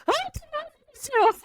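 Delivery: very high laughing squeal; joyful squeal. Retold or imitated. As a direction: squeal of joy through laughter. Retold joyful reunion.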